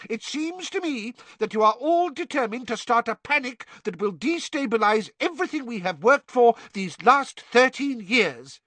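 high, loud, matching her anger; shouting Fudge. A narrator's reading. Fudge angry.

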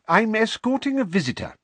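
Mr Weasley voice. Acted. Mr Weasley announcing to guard.

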